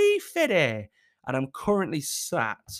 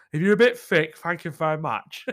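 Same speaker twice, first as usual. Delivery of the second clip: jokey jab; 5 dB louder than usual. Real, not acted. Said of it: "It sounds like I'm offending you all there".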